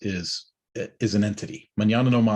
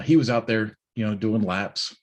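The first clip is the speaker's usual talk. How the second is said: ordinary delivery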